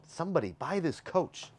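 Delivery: high, playful sign-off plea; high-pitched plea. Real, not acted. Host urging viewers to buy the coach, high-pitched plea. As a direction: plead high, at normal volume.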